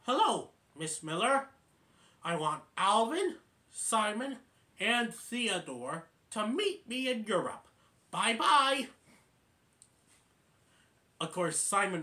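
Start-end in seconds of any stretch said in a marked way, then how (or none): 0.0-8.9 raised, bright-toned phone-message act; Dave. Retold or imitated. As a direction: call it out item by item, lilting upward. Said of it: "he needs Dave to say".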